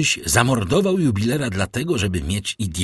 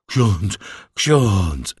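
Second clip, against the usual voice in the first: louder, frightened repetition with falling tone; stammering dread; 4 dB louder than usual. A narrator's reading. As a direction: blurt the title, rattled and at a loss. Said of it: "wybełkotał, zaciskając pięści i dzwoniąc zębami".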